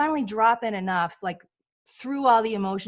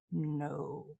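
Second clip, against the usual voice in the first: much lower and softer, a deep hushed no; low soft inner voice; 7 semitones lower, 11 dB quieter than usual. Retold or imitated. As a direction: let the heart answer low and quiet.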